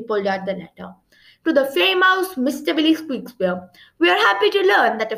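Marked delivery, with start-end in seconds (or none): none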